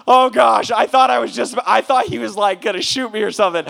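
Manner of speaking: loud, high, flustered relief; mock panic. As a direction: blurt it out after a big scare. Retelling his panic at the stranger.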